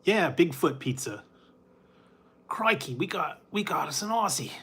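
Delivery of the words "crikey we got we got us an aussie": put-on lightly Aussie-accented nature-host voice; hammed-up Aussie accent, a little higher; mock Aussie. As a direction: play the Aussie wildlife presenter. Aussie croc-hunter impression.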